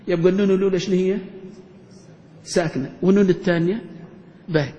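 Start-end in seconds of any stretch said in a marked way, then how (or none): none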